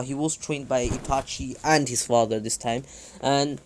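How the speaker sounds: plain reading voice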